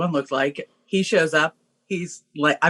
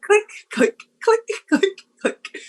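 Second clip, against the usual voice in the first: high, squeaky clicking; mimicking locks snapping shut one by one; imitated lock clicks; slower than usual. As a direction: tick off each deadbolt in a tiny high voice. Imitating seven deadbolts locking.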